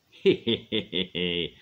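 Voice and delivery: loud wicked cackle; witch cackle. A narrator's reading. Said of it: "cackled the wicked witch".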